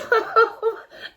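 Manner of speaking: helpless high laugh, cracking up; real burst of laughter. Real, not acted. A vlogger cracking up at a puppy in a hat, real laughter. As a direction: laugh out loud at the dog's hat. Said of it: "I can't stop laughing".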